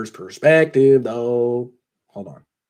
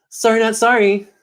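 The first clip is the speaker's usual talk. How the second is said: a lightly voiced coach, higher and breezy; Kyle Shanahan voice; 9 semitones higher than usual. Podcaster imitating Kyle Shanahan brushing off Detroit, higher. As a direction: toss it off the way Kyle Shanahan might. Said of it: "That's how Kyle Shanahan would say it".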